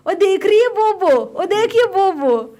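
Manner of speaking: put-on an old man hollering to his daughter-in-law; loud high call, the last words drawn out slowly; shouting across the bus. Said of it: "इतनी जोर जोर से बस में चिल्लाने लगा".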